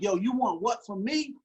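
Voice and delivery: put-on teen confronting his father, aggressive; getting aggressive. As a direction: square up and demand an answer. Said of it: "Now I'm kinda getting aggressive".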